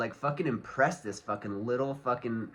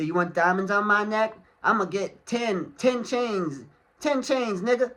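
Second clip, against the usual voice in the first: mock rapper boast; 8 semitones higher, 4 dB louder than usual. Mocking rapper's flashy boast.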